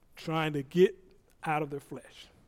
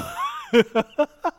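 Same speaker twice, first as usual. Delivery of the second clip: high laugh; 4 dB louder than usual. Real, not acted. Laughs at his own joke.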